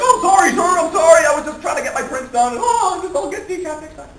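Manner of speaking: high, pleading apology; cowed kid. Acted. Kid photographer apologizing to the bullying publisher, cowed and pleading. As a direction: squeak out frantic mock apologies. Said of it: "The kid's like".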